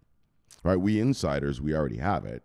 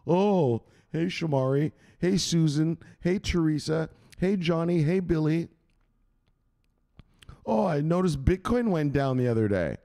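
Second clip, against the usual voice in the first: higher mocking singsong greeting each name; mock the smug gloating friend; 7 semitones higher than usual. Imitating smug friends gloating at a crypto holder, mock singsong. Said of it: "they wanna gloat and they wanna laugh at you".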